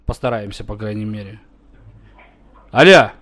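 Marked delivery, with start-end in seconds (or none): 2.7-3.1 loud phone hello. Real, not acted. Calling into the phone.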